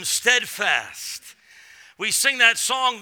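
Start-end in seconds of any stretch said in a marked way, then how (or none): none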